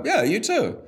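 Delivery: high, chirpy mock-polite reply; returning the goodbye. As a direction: echo his cheer back at him. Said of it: "I go".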